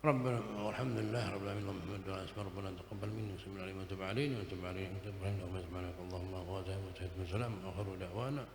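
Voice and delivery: fast, soft Arabic recitation; murmured fast prayer to end the sermon; rapid closing prayer. Real, not acted. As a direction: rattle through the closing du'a quietly.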